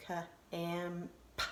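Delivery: "Ka, am, p" as separate sounds; sounded out. Shown as a demonstration. Teacher sounding out the first word, sound by sound. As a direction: break "Ka, am, p" apart, softly. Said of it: "Then I hear ka".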